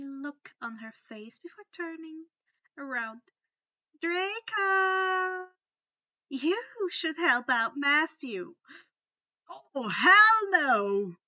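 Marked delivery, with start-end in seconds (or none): 4.0-8.5 loud call across the room. Acted. Luna calling Draco over loudly. 9.7-11.2 loud, slow, indignant refusal; indignant outcry. Acted. Draco refusing Luna, loud and indignant. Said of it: "He called out indignantly".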